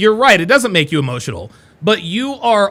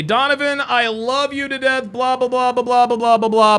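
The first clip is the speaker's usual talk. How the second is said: higher, droning mock gushing; mock flattery; 4 semitones higher than usual. Host mimics chatters who come in and glaze, mock flattery. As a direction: parrot a flatterer running on into blahs.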